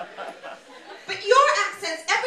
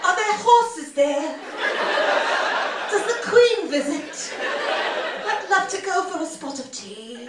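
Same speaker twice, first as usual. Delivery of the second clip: enchanted mock-British tourist; 4 semitones higher than usual. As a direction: play a naive admirer charmed by British talk. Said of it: "It all sounds beautiful to me".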